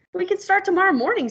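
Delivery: high, fast excited pig; eager pig. Acted. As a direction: rush it out like a thrilled pig. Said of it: "said the pig".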